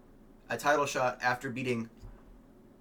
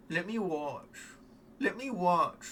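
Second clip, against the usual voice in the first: mock Gary voice; 5 semitones higher, slower than usual. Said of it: "He might be like".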